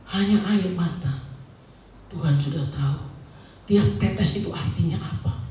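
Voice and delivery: ordinary delivery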